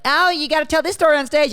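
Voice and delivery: high, gushing in-law urging him on. Retold or imitated. In-laws egging the comedian son-in-law on, high and gushy. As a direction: chirp it like a pushy in-law. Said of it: "they, they're like".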